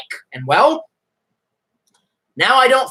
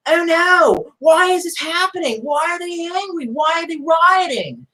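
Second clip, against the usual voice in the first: much higher, whiny mock voice; mocking clueless coworkers; 9 semitones higher, slower than usual. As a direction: play them naive and fretful. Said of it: "we're just like".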